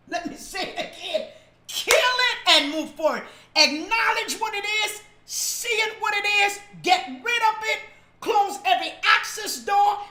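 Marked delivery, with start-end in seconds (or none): 1.7-10.0 higher, louder, driving preaching; building preacher's cry; fiery preaching. Real, not acted. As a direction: preach it with full force. Preaching at full force.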